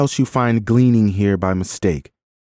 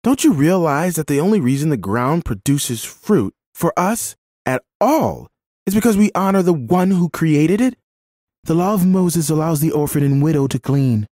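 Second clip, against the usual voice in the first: higher-pitched, urgent pleading of the law; impassioned protest; 9 semitones higher than usual. A narrator's reading. Woman defending a gleaning orphan to the landowner, impassioned. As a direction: argue earnestly on the child's behalf.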